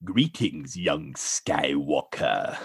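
very low, slow evil emperor; sinister, drawn-out villain greeting; creaking dark lord, deep and slow; Emperor Palpatine. Acted. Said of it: "Emperor Palpatine, so played by Ian McDiarmid".

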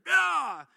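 loud sudden angry yell; angry yell of frustration. Retold or imitated. Preacher acting the driver yelling as he swerves past. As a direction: blast out an angry shout as he speeds off. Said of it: "darts around her and just".

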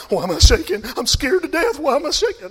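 imitating a panicked driver, rising in pitch; mock panic. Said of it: "I'm like".

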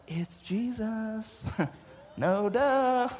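soft, drawn-out "no duh" delivery; mock obviousness. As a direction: stretch it out quietly as if obvious. Mock obvious hindsight reaction.

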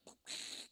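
short voiceless whoosh; firework fizz. Retold or imitated. Showing how little fireworks go, imitated sputter. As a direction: mimic a small firework fizzing.

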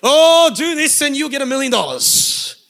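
loud, high, hammed-up exclamation; gushing excitement. Preacher voices the Spirit promising a blessing, gushing excitedly. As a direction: act thrilled, with a big drawn-in "Oh". Mimics a welcome, lucrative blessing.

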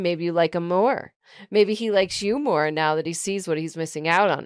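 ordinary delivery

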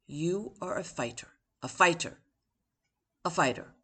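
plain reading voice

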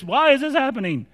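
high-pitched exasperated wail, re-enacted; losing my marbles. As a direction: whine in mock despair at your bad luck. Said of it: "me losing my marbles".

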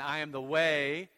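loud lead for the church to repeat; call to the congregation. Acted. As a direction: lead the room in the chant. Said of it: "Say it with me again".

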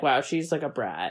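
quiet, low muttered judgment; muttered aside. Retold or imitated. Friend mutters about the annoying teen, low and quiet. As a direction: grumble it under your breath. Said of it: "I was like".

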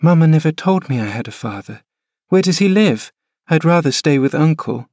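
put-on high, plaintive child voice, pitch rising; a frail boy pleading to stay; sickly boy. Linton protesting to his nurse, high whining boy.

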